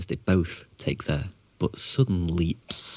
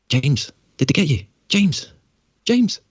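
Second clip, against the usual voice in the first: rising shouts, higher each time; desperate calling; 8 semitones higher, 6 dB louder, slower than usual. Acted. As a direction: call out louder and louder into the void. Character calls for lost friend.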